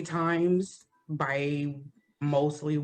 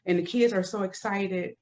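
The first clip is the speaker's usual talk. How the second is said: ordinary delivery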